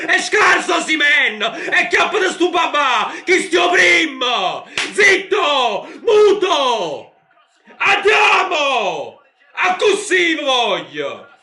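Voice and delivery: high excited yelling; celebration shout. Real, not acted. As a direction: shout in triumph at the critics.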